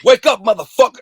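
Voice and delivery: loud, high wake-up yell; retold shout. Storyteller waking the passed-out man, loud acted shout. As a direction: shout him awake, as retold.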